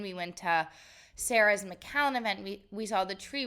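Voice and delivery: ordinary talk, nothing marked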